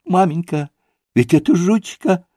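high-pitched overjoyed father, nearly crying; blissful cries. A narrator's reading. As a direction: call out in childlike rapture. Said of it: "выкрикивал он блаженным голосом".

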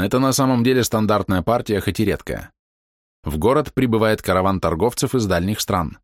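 ordinary delivery

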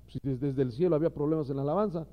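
ordinary delivery

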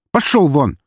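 loud, high angry order to leave; shouted dismissal. A narrator's reading. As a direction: yell to chase him off. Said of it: "крикнул на пьяного полицейский".

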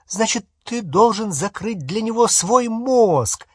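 high-pitched, insistent order; sharp. A narrator's reading. Hermione ordering Harry to close his mind, sharp. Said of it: "резко сказала Гермиона".

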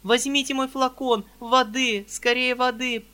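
frantic companion calling; alarmed cry. Acted. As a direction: cry out for the fainting lady. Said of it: "кричала Анфиса Петровна".

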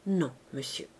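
low, soft, downcast reply; hushed, respectful no; subdued refusal. A narrator's reading. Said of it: "Il baissa les yeux et répondit".

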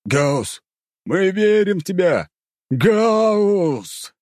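high, loud drawn-out chant of faith; fervent high calling; praying crowd shouts. A narrator's reading. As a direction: cry the name out like a pleading congregation. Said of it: "Люди кричали, молились".

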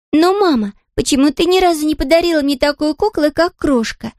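put-on high puzzled child voice; puzzled little princess. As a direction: ask high and wondering, like a child. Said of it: "недоумевала Лиси Лотта". (высокий недоумённый детский голос)

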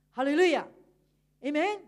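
loud ringing 哈利路亚; big preacher's hallelujah; hallelujah shout. Real, not acted. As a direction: call it out to the congregation. Preacher calls out praise.

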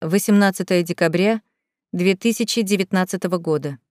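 plain reading voice

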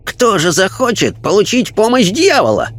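high, fussy fanatic's protest; indignant fanatic. A narrator's reading. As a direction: bristle with outrage at the nonsense. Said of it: "шумный и вспыльчивый персонаж".

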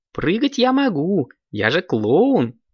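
happy, high-starting exclamation; delighted. A narrator's reading. Clown Nudik joins the jumping, delighted and high. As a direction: start high with glad relief, then stretch it out. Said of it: "обрадовался он".

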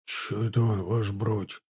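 low, hushed aside to an officer; whispered question. A narrator's reading. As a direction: ask it under your breath. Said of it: "прошептал едущий рядом Чанов".

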